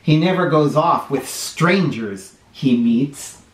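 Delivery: put-on lively storybook read-aloud for little kids; sing-song picture book reading; reading to children. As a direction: read it like story time on the rug.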